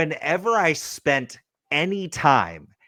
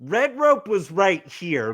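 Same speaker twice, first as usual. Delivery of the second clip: normal talking voice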